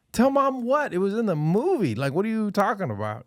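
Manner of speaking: hammed-up outrage at a tattletale; mock incredulous retort. Dad answering his daughter's threat, high and incredulous.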